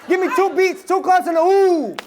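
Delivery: high chanted call to the crowd; hype call. Shown as a demonstration. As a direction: lead the chant, pumping them up. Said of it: "one more time".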